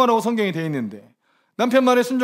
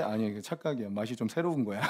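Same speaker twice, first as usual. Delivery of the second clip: sly mock hushed wife; 9 semitones lower, 11 dB quieter than usual. Mock wife deceiving husband.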